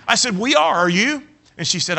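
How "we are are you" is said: high, loud sassy comeback; cheeky high retort with a rising Are you; smart aleck. Retold or imitated. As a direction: fire it back like a smart aleck. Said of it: "you're kind of a smart aleck".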